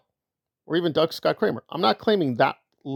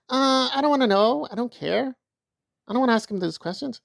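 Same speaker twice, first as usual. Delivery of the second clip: a touch higher, dismissive mock whine; mocking Liz; 7 semitones higher than usual. Mocking Liz walking away, in a lightly higher whine. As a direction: brush it off like you don't care. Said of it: "Liz Gale is like".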